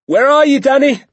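high, loud searching shout; father calling out. A narrator's reading. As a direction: holler for your son across the woods. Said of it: "my father called out".

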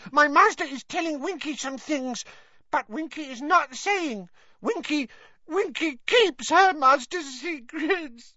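high elf voice, slowing on the secrets; guard the secret with pride; Winky refuses to tell, high and prim; loyal house-elf Winky. Acted.

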